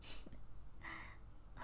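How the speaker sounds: very quiet half-laugh; faint breathy laugh. Acted. Vampire about to name love, faint laugh. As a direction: laugh faintly before confessing. Hesitates before saying love.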